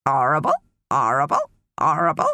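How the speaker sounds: squawky parrot echo of one word; parrot chorus. A narrator's reading. Parrots echo the mermaid's disgust, squawking in chorus. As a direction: repeat it like mimicking parrots. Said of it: "chorused the parrots".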